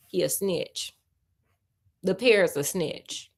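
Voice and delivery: ordinary delivery, nothing marked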